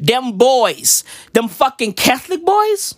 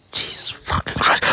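voiceless, softer exasperated aside; whispered oath; 7 dB quieter than usual. Real, not acted. Comic scolding himself for not being serious, whispered. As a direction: swear under your breath.

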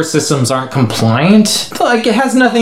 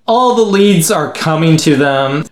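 ordinary delivery

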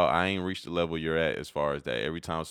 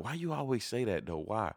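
higher, lightly voiced questioning; imitated puzzled friend; 5 semitones higher, 4 dB quieter than usual. Homeboy asking at Popeyes, imitated puzzled question.

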